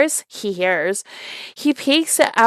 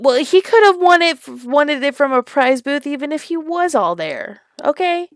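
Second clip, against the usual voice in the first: mock retort; 6 semitones higher, 3 dB louder than usual. Mocking retort to story narrator.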